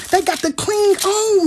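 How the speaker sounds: impassioned preacher's wail; preaching cry. Acted. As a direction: stretch it out like a preacher climbing. Repeats the line, building up.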